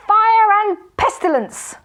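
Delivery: very high, loud, slow oath; furious curse. A narrator's reading. Magician finding the hat, furious loud curse. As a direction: spit out the curse.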